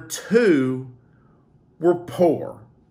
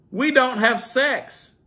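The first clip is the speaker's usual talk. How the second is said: much higher, slow sarcastic reading; mocking read; 13 semitones higher, slower than usual. Vlogger reading a commenter's claim, mocking. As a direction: read it with disbelief.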